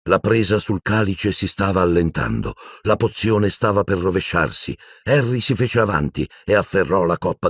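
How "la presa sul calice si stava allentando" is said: usual speaking voice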